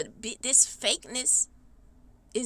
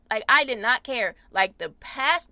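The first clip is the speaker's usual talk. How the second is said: usual speaking voice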